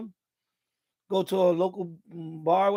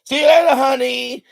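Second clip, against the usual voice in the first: louder, higher sleazy goodbye; mock flirty guy; 4 semitones higher, 6 dB louder than usual. What he would never say to a woman, mock flirt. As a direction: ham up a smooth flirt. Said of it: "I would not say".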